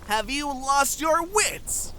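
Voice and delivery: high, drawn-out, indignant challenge; outraged disbelief. Acted. Scolding a comrade who defends the enemy, outraged. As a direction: throw the question at them in outrage.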